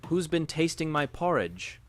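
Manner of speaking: mild angry grumble; deep angry grumble. A narrator's reading. Papa Bear finding his porridge eaten, mild grumble. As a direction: grumble it like a cross father bear. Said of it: "grumbled Papa Bear in his deep, angry voice".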